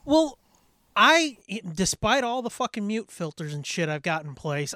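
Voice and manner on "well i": normal talking voice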